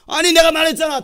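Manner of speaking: high, loud exasperated protest; frustrated outburst. Acted. Frustrated speaker reminds the doubter, raising the voice. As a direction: burst out as if nobody listens. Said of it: "답답하니까".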